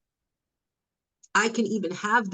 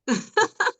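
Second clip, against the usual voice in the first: a bright burst of laughter at her own joke; laughing at her own teasing; high laugh; 14 semitones higher than usual. Real, not acted.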